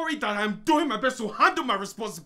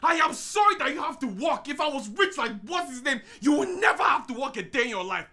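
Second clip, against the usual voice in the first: high, loud, defensive outburst; angry shouting; 4 semitones higher, 3 dB louder than usual. Acted. Husband defending himself to wife, shouting angrily. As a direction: shout the apology back at her.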